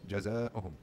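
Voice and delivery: ordinary talk, nothing marked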